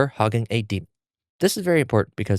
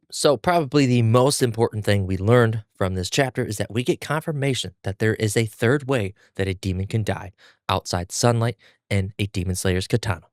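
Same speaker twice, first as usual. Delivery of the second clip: ordinary talk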